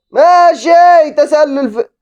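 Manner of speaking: high, drawn-out cry; excited shout. Real, not acted. Gamer shouting as the attack unfolds. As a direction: stretch it high in suspense. Reacting to a play.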